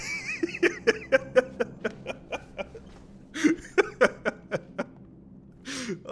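long laugh close to crying; hysterical laugh. Real, not acted. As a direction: laugh until you nearly cry.

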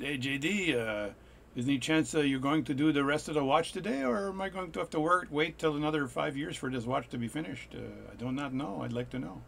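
put-on French accent; playful mock French accent. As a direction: imitate an email writer in a French accent. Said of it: "said probably with a French accent".

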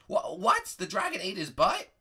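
usual speaking voice

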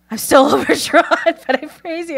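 high, overwhelmed mock crying; imitating tearful praise. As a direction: praise through imitated happy tears. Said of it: "he's literally crying".

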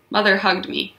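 ordinary delivery

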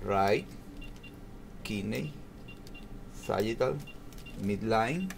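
soft, word-by-word dictation while typing a label; quiet typing dictation. Shown as a demonstration. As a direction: murmur each word to yourself as you type it in.

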